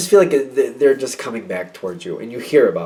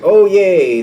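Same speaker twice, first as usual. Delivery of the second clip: high, loud cheer imitating eager people; mock excitement; 7 semitones higher, 6 dB louder than usual. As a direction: put on a gleeful little cheer. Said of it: "they're all happy and all, they're all excited".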